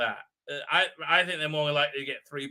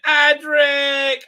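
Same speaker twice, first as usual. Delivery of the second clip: higher, louder anguished name call; Fifth Doctor; 9 semitones higher, 9 dB louder than usual. Retold or imitated. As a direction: cry out the companion's name in mock grief. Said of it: "as the Fifth Doctor said".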